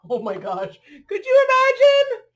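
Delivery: loud, high amazed exclaim. Real, not acted. Reacting to the dog clinging to the wing, loud and amazed.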